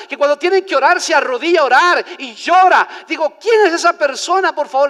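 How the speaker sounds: loud, high retold questioning; fervent preached retelling. Visiting preacher asking pastor about that worshipper, fervent preached retelling. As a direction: act out amazement at full preaching force.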